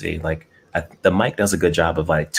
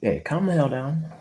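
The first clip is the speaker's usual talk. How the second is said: high, exasperated pet scolding; high voice telling the cat off; 9 semitones higher, 4 dB quieter than usual. Real, not acted.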